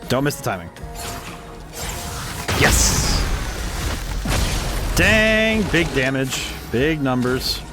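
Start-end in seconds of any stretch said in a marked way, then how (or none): none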